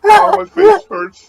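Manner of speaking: laughing. Real, not acted. Laughing fit after ASMR bit.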